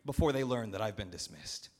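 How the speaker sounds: very high, loud urgent yell; shouted order. Acted. Steward ordering the servant out to fetch farmers, shouting. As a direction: yell him into action.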